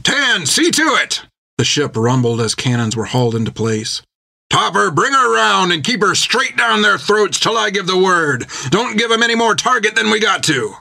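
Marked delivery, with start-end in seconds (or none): 0.0-1.2 high, slow call down the hatch; captain calling down into the hatch; shouted order. A narrator's reading. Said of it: "bent low to shout into the hatch". 4.5-10.8 higher, firm sea-captain orders; a hint of a gruff captain; captain's command. A narrator's reading. Jack commands the helmsman.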